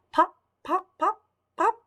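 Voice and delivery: high little popping noises; popping sounds. Acted. Storyteller making the eggs hatch, high pops. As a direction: pop pop like eggs cracking open.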